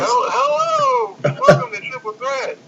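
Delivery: high, shouted mock game show host. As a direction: belt it out like a cheesy TV host. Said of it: "you can't even sound like a damn game show host".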